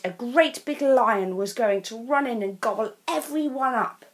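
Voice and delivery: plain reading voice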